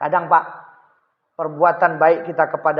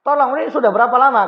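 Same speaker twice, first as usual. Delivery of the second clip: higher, fast, anxious asking; fast, high urgent plea; urgent question; 6 semitones higher, 3 dB louder, faster than usual. Acted. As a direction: press him hard for how long. Neighbour urgently asks.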